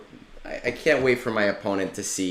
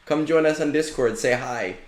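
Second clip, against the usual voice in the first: higher, louder, eager hello to a stranger; hyped-up friendly greeting; 6 semitones higher, 4 dB louder than usual. Retold or imitated. Inviting Caleb's friend into the Discord, higher and louder with eager cheer. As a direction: call out to him like an excited buddy. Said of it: "give him a link and be like".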